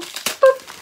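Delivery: high, loud little boop; sudden high-pitched sound effect; playful boop. Real, not acted. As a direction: pop out a squeaky boop. Playful noise while opening crinkly packet.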